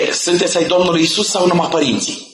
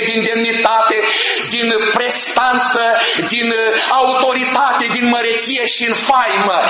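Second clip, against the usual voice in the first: fervent preaching; 5 semitones higher than usual. Acted. Preacher proclaiming to the congregation that the Lord never changes, fervent. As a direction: preach it out to the whole church.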